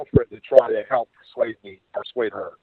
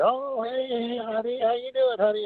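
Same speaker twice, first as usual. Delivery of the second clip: higher, softer sweet-talking woman; sugary woman's greeting; 13 semitones higher, 4 dB quieter, slower than usual. Retold or imitated. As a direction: coo it like she's luring him in. Greeting quoted from Sue.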